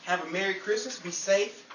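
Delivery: ordinary talk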